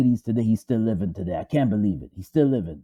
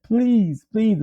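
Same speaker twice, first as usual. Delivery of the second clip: higher pleading voice, a touch of desperation; wife pleading for the boy, higher and urgent; 6 semitones higher than usual. Retold or imitated. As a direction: beg them to spare the boy. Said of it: "she pleaded with them".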